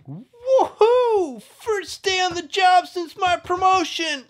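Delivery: put-on high, loud, thrilled young officer; eager bunny cop. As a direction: burst with first-day excitement. Toy bunny cop voiced.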